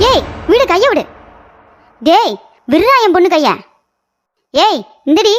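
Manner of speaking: angry protest. Acted. Mother-in-law pulls hand away.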